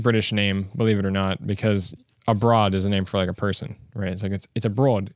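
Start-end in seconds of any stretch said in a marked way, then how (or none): none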